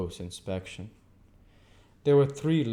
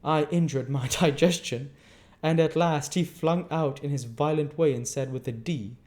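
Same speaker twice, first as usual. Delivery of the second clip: prim lady; 7 semitones higher, 5 dB louder than usual. Acted. Camilla's long complaint.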